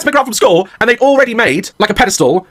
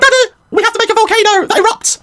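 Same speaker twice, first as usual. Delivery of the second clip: put-on high little-girl voice pitched way up; imitating his daughter. Dad imitating his daughter's school-project request in a high squeaky voice. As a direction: do the kid asking for help, Elmo-like. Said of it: "She's like".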